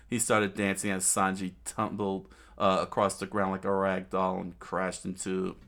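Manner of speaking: usual speaking voice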